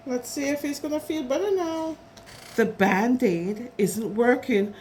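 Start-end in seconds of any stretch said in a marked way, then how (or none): none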